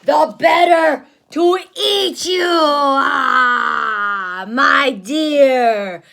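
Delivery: loud shouting wolf; wolf's roar. Acted. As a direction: pounce with a roar. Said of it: "cried the wolf".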